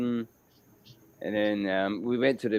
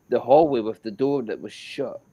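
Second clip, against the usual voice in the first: ordinary delivery, nothing marked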